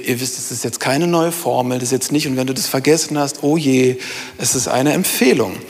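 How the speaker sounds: ordinary talk, nothing marked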